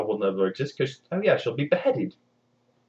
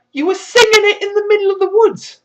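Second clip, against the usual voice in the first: much higher, louder mock-exasperated; much louder incredulous jab; withering retort; 11 dB louder than usual. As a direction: spell out the obvious. Queen's withering reply.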